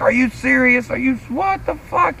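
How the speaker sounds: high-pitched incredulous questions; retold disbelief. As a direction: imitate stunned bandmates in a high voice. Retold band reaction to famous drummer.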